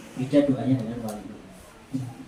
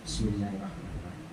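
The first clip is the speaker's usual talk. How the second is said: murmured prayer; 6 semitones lower, 10 dB quieter than usual. Acted. Scholar's murmured prayer before eating.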